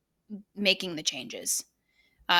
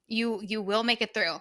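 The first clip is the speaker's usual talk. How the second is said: usual speaking voice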